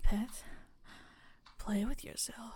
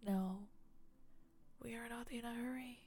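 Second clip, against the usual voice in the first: put-on close warm intimate boyfriend; 9 dB quieter than usual. Tender aftercare after the scene.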